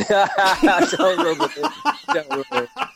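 high, squeezed out through laughter; laughing. Real, not acted. Friend teased about the poor teacher, protesting through laughter. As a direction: barely get the words out for laughing.

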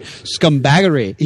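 light imitation of a New Zealand accent; Kiwi accent. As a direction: say it like a Kiwi would. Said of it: "I get this Kiwi accent".

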